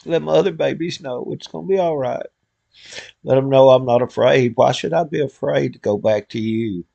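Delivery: earnest spoken prayer to God; heartfelt prayer. Real, not acted. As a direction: pray aloud for friends and family.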